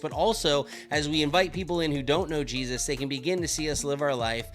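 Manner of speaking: soft, sincere promo over music; ad read. Acted. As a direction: pitch the book gently to listeners.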